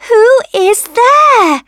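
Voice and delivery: high, loud, slow rosebud demand; loud calling. A narrator's reading. Rosebud to all the tapping, loud drawn-out question. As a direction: call it out high, stretching each word.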